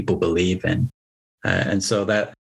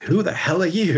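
incredulous villager; 8 semitones higher than usual. Retold or imitated. Villagers rebuff stranger.

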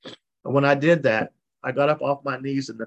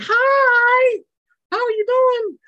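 imitating her in a much higher, louder voice; mock cheery greeting; 8 dB louder, much slower than usual. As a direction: ham up the sugary big-book woman saying hi. Mocking Sherry's cheery greeting.

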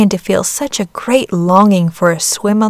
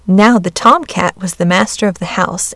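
ordinary delivery, nothing marked

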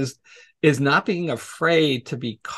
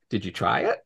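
deadpan retort; 6 semitones lower, 4 dB quieter than usual. Retold or imitated. Retold dry answer to obvious question.

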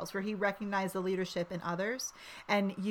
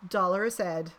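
toast; 9 dB louder than usual. Acted. Raising a toast.

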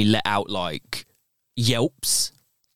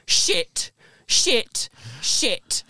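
panicked cursing; 11 semitones higher, much slower than usual. Retold or imitated. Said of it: "her just going".

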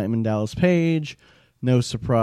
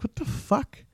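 much higher, softer incredulous gasp; a breathless, high what-the-hell reaction; stunned disbelief; 13 semitones higher, 7 dB quieter than usual. Retold or imitated. Fan retelling a disappointing movie, high stunned disbelief. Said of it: "it's like".

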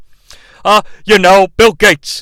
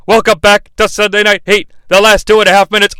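ordinary delivery

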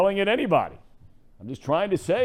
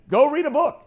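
mock taunting yell; 9 semitones higher, 4 dB louder than usual. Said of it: "people are gonna be screaming".

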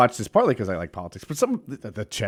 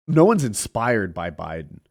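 ordinary delivery

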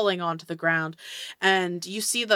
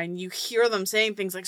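ordinary talk, nothing marked

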